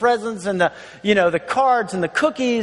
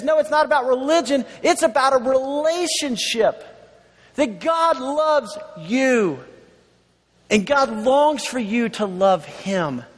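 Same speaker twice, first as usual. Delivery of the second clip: tender Jesus; 3 semitones higher, slower than usual. Acted. Jesus voiced, slowing to tender.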